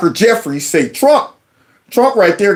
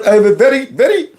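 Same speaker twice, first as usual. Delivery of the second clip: exaggerated Indian accent, pitched up; mock Indian accent; 7 semitones higher, faster than usual. Host mimicking a congressman's speech, mock Indian accent. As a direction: imitate a heavy Indian accent. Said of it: "that very heavy Indian accent".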